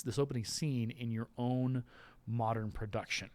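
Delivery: ordinary delivery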